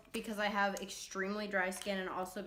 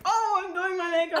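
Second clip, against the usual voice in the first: mock voice; 10 semitones higher, 11 dB louder than usual. Mocking themselves in the mirror.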